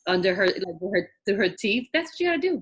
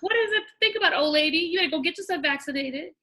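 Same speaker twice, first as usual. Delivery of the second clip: teasing scold; 9 semitones higher, faster than usual. Retold or imitated. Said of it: "I'm like".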